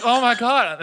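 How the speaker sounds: high, loud gasping disbelief; mock horror. Strangers hearing the fake escape story, mock shock. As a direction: exclaim it as a horrified listener. Retold horrified reaction of listeners.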